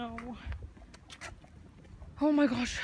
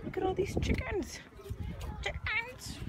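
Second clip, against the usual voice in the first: excited; 13 semitones higher, 8 dB quieter than usual. Real, not acted. Excited at chickens.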